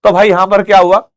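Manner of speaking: ordinary talk, nothing marked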